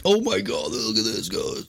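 high, breathless mock amazement; gullible excited gasp. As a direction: gasp like you believe it. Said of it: "not be like".

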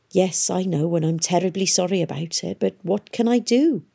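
ordinary delivery, nothing marked

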